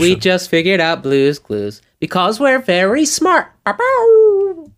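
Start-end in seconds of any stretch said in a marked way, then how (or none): 3.7-4.7 high cartoon sound effect. Blue's Clues sound imitation.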